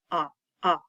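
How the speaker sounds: repeated isolated "ah, ah" for short O; teacher demonstrating the short vowel AH. Shown as a demonstration. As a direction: sound out the short O as AH, twice. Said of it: "One is a short O".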